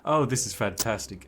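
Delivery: ordinary delivery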